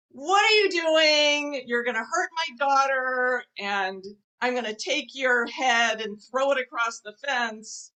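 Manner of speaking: imitating a screaming dad; retold screaming. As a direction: yell it in imitation, drawing out the words. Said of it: "he starts screaming at me".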